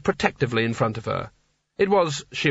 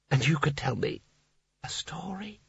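soft breathy whisper trailing off; 2 semitones higher, 8 dB quieter than usual. A narrator's reading. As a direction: coax her in a hush. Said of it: "He lowered his voice".

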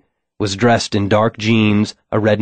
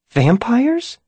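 much higher, stunned disbelief; incredulous hiss. A narrator's reading. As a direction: hiss it, not believing. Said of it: "Sarah hissed, incredulous".

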